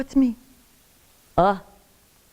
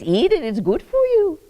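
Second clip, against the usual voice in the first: higher mock-bossy nurse, in the grandmother's Yiddish accent; mimicking the nurse; 9 semitones higher than usual. Grandmother mocking the Irish nurse who forces food on her, mock-bossy. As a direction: lightly voice the pushy nurse. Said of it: "She leans over me and says".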